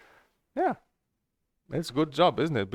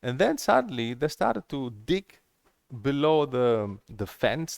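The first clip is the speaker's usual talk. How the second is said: normal talking voice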